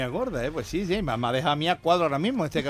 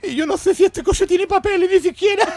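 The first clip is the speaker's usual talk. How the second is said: clueless driver; 14 semitones higher, 6 dB louder than usual. Acted. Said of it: "Y dice el conductor".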